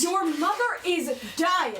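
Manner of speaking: the secret bursts out; distraught outburst. Acted. Aunt blurting the bad news to the children.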